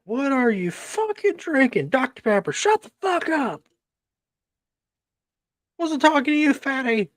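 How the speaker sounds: high squeaky taunting voice; squeaky mock voice. Snapping at Doctor Pepper drinker.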